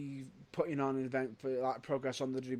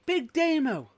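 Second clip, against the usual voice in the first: excited call-out; 11 semitones higher, 8 dB louder, slower than usual. Real, not acted. Excitedly calling out a name.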